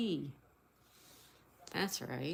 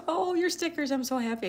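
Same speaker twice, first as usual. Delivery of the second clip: much higher, louder "Oh, your stickers"; excited squeal; 8 semitones higher, 3 dB louder than usual. Real, not acted. Streamer thrilled a viewer got stickers, high excited squeal. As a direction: gush with joy for them.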